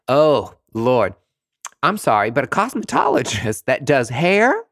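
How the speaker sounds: ordinary delivery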